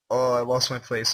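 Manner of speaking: usual speaking voice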